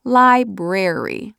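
"Library" drawn out at half speed, LI-BRARY, both r's clear; slow, careful "Library". Shown as a demonstration. Author practising the word she stumbled on, slow and careful. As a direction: practise "Library" slowly, syllable by syllable. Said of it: "I had trouble pronouncing library".

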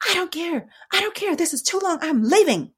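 very high, exasperated mock protest; imitated angry outburst. Voices son storming out.

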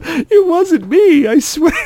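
very high, drawn-out mock pleading; hammed-up squealed protest, laughing; panicked denial. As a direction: plead innocence through a laugh. Friend protests while being beaten.

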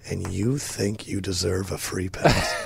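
low, slow, quiet scolding; hushed, deliberate mock reproach; imitated disapproving wife.

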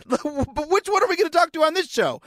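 high-pitched pretend listener question; eager audience member. Host playing a listener asking about today's guest, eager. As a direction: pipe up as an excited fan.

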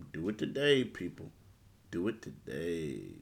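upbeat sponsor read; hard sell ad. Acted. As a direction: sell it like a radio spot. Host reads sponsor ad.

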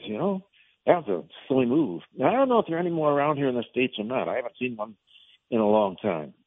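usual speaking voice

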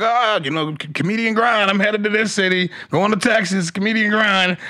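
hyped, high, pumped-up voice; fired-up self quote. Retold or imitated. Comic mocking his own road posts, hyped up. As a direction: sell the grind like a hype post. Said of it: "I'm all fired up about it".

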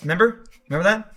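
"remember" without its first syllable, as "member"; slurred together. Shown as a demonstration. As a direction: swallow the start of "remember". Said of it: "slur together all the words".

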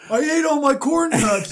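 loud, high, bragging yell; child in the back seat, loud and high; hyped kid. Acted. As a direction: yell it like a snack-crazed kid. Kids hyped about snacks.

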